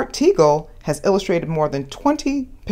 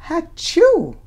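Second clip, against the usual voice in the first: high, loud hammed-up achoo; mock sneeze; 12 semitones higher, 5 dB louder than usual. Dinosaur with the flu, a loud playful sneeze. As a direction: give a big silly sneeze.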